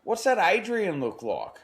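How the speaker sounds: high excited quote of the tradie; quoted bloke. Retold or imitated. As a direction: pitch up for the pest bloke. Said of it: "And he goes".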